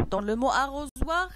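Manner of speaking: usual speaking voice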